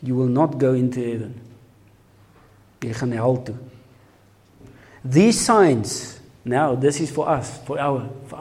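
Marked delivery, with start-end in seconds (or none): none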